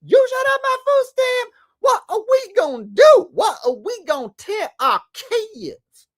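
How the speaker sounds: very high, loud mock distress; squealing parody of a desperate complainer; mocking impression. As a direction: wail it as hammed-up outrage. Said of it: "mock it".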